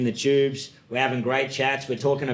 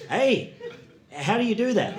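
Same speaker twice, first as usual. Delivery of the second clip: higher, puzzled question; mock-curious, pitched-up query; baffled learner; 7 semitones higher than usual. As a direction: ask like a clueless trainee.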